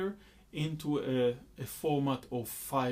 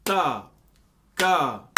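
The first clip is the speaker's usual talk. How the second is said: Ta and ka hit loud and heavy as downbeats; syllables as downbeats; 9 dB louder than usual. Shown as a demonstration. Teacher accenting Ta and ka as downbeats. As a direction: land hard on Ta and ka. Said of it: "will feel like a downbeat".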